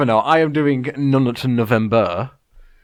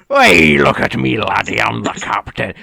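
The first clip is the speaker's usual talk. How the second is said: mild Scottish pirate; 5 semitones lower than usual. Retold or imitated. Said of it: "Slightly scarier".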